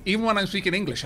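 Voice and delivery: usual speaking voice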